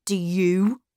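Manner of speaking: mildly cold challenge; hard, cold voice. A narrator's reading. Miles turning on the governess, hard cold voice. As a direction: turn the question back coldly. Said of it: "in a very different, hard, cold voice".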